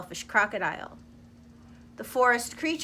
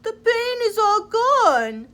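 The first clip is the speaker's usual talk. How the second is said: much higher, louder, slow relief; relieved crocodile; 11 semitones higher, 8 dB louder, slower than usual. Acted. Crocodile sitting up after the tooth is pulled, relieved. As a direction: marvel that the pain is gone. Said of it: "The crocodile sat up and said".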